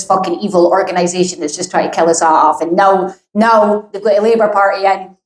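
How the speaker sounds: ordinary delivery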